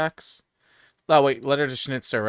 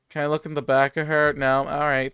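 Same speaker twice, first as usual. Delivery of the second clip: normal talking voice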